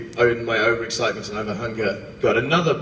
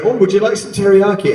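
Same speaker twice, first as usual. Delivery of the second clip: mock offer; 6 semitones higher, 6 dB louder than usual.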